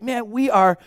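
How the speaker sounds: mildly hammed-up amazement, high pitch; retold awe, high and loud at the start; awed. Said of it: "watching this in awe".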